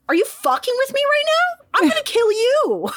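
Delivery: high, half-laughing outrage; hammed-up fury, breaking into a laugh; mock fury. Alexander learns his rival was killed, mock outraged and laughing.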